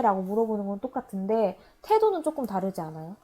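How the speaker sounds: usual speaking voice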